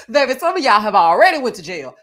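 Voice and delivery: loud, laughing jab at the cast; loud laughing call-out. Real, not acted. Host roasting the reunion cast with a loud, laughing shout. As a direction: shout it out while still laughing.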